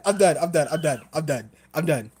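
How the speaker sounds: ordinary delivery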